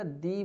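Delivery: long ee in "the"; "the" as "dee". Shown as a demonstration. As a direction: say the as "dee" before a vowel. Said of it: "यहाँ पे आपको क्या बोलना पड़ेगा". (the को "दी" बोलें)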